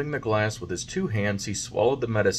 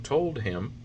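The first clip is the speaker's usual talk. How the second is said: ordinary delivery, nothing marked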